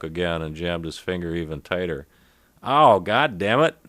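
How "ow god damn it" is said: loud, high, pained nasal cry, slowed; painful nasal outburst with a twang. Acted. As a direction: yell it through a finger jammed up your nose. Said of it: "he said in a distinct nasal twang".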